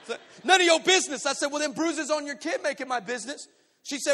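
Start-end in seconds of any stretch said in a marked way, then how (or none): 0.4-1.2 loud, high snapped comeback; acted angry retort. Large woman looking down at intruder, angry retort. 1.5-3.5 acted confrontation. Acted quarrel at the door.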